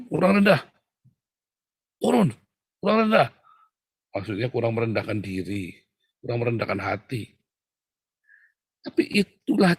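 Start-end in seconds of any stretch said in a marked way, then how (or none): 0.1-3.3 high, loud push-up trainer's order; barked correction. Acted. Trainer to man doing push-ups, loud command. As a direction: call it out like a drill coach. Acts out trainer pushing him lower.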